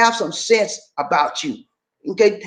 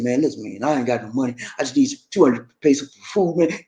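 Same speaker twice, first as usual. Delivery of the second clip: retold beggar's plea; 8 semitones lower than usual. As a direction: lightly imitate a man asking for cash.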